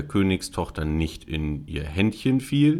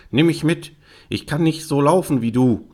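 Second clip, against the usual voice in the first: high, raised pleading frog voice; frog calling out; 8 semitones higher, 3 dB louder than usual. Acted. Frog begging the princess to take him, calling. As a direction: call after someone who is leaving you. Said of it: "rief der Frosch".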